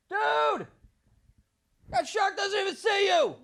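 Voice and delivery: high, slow goofy buddy voice; mock the scared friend in a high dopey voice; high drawn-out buddy impression; buddy's mocking voice.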